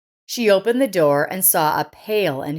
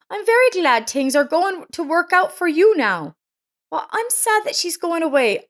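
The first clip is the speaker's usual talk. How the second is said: higher, shy and grateful; embarrassed woman's voice; 9 semitones higher than usual. A narrator's reading. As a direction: voice Lisa, higher, a little bashful. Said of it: "Lisa, embarrassed ... and replied".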